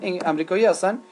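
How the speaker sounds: ordinary delivery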